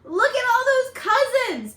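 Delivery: bright wow at the crowd; excited. Acted. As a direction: point out the crowd with glee. Excited aside, then self-corrected.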